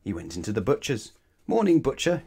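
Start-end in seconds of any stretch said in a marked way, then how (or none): 1.5-2.2 bright cheery greeting, pitched up; cheerily. Acted. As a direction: call out a happy hello. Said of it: "he said cheerily".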